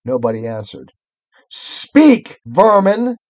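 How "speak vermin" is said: sharp high command to vermin; evil queen. Acted. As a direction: spit it out like a tyrant queen. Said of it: "she said again".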